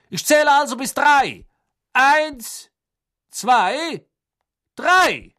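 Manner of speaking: very high-pitched, projected stadium announcement; booming announcer. A narrator's reading. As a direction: call out across the water to the crowd. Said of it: "ließ seine Stimme über das Wasser ... dröhnen".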